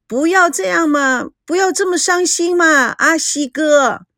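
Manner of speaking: high, tearful pleading; a hint of tears, begging him; crying. A narrator's reading. Meijiao begging in tears, high and tearful. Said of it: "美娇哭了".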